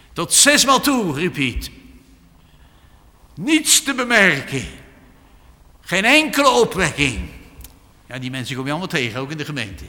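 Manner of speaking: loud, high preaching that builds up; fiery preaching. Acted. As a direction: urge the congregation with full force. Preaching at full force.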